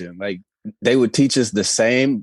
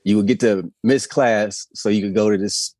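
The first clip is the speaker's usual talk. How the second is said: usual speaking voice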